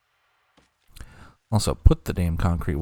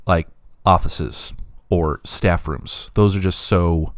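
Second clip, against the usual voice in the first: ordinary delivery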